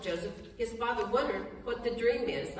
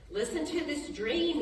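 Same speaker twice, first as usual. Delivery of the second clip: high, eager boast about the dream; boastful excitement; 7 semitones higher than usual. Retold or imitated. Brother boasting to brothers, high eager voice. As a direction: Joseph showing off to his brothers, excited and boastful. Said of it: "as if that's a good idea".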